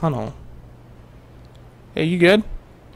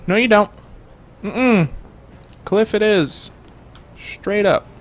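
firm mom; 6 semitones higher, 3 dB louder, slower than usual. Retold or imitated. Mom overruling kid in skit.

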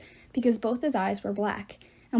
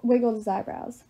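plain reading voice

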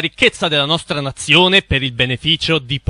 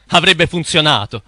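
usual speaking voice

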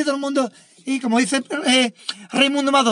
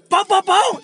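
three high, punchy impact noises; comic sound effect of blows landing; imitated kicks; 8 semitones higher, 3 dB louder, slower than usual. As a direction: voice the kicks as sharp high bangs.

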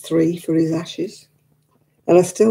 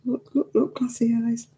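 teary whisper; 6 semitones higher, 4 dB quieter than usual. Real, not acted. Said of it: "Look at me, I'm crying now".

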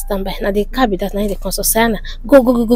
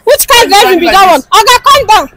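high-pitched roadside ordering; heated confrontation; 3 semitones higher than usual. Acted. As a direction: order the driver about, voice raised in pitch. Roadside quarrel with another driver.

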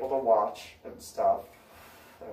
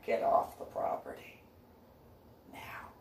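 lower, soft whispered threat; quiet lowered final get off; low final order; 11 semitones lower, 8 dB quieter than usual. Retold or imitated. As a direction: growl it low and hushed. Final warning.

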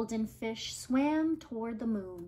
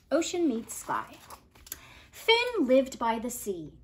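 ordinary delivery

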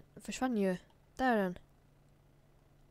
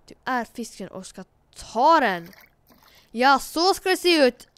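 loud coaching; 2 semitones higher, 12 dB louder than usual. Pretend. Coaching character to fish.

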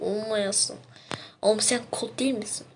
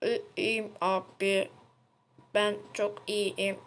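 robot voice; 5 dB quieter, slower than usual. Acted. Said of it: "robot olduğu için sesi kötü geliyor".

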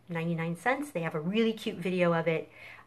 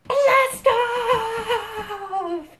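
excited shout; 8 dB louder than usual. Real, not acted. End of countdown with kids.

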